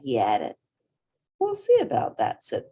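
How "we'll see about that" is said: high, quick, determined hippo voice; stubborn little hippo. Acted. Portly answering the bat, high and stubborn. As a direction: toss it off with pluck.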